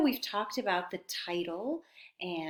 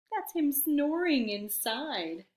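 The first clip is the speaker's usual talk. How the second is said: plain reading voice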